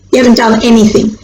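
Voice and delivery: ordinary delivery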